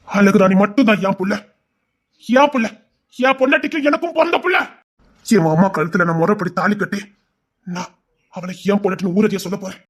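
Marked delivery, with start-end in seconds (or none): none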